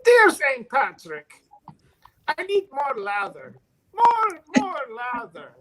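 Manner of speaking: loud Irish brogue, falling at each phrase end. Acted. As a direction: cry out your plea in an Irish accent. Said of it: "your best Irish brogue".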